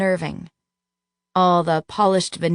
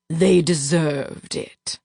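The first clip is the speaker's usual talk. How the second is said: cold villain; much slower than usual. Acted. Villain gloats, mad duke.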